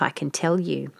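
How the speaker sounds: ordinary delivery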